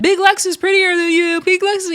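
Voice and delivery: high, sing-song mock taunt; imitating Natalie taunting Scotty. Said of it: "Natalie put in Scotty's head".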